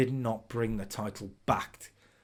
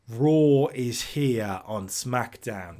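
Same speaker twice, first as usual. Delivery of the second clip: dramatic announcer; 6 dB louder, much slower than usual. Pretend. Dramatic invasion call.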